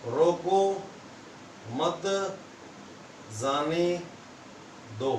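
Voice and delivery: "रोको, मत, जाने, दो" with long gaps between the words; word-by-word reading. Shown as a demonstration. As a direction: spell out "रोको मत जाने दो" word by word, pausing after each. Teacher repeats the example sentence slowly. ("रोको मत जाने दो" हर शब्द के बाद लंबा विराम)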